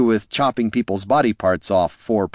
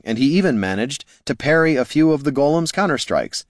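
plain reading voice

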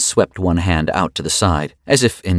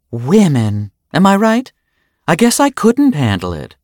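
much higher, louder sarcastic mimicry; mocking jibe; 12 semitones higher, 6 dB louder than usual. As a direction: throw his insult back at him.

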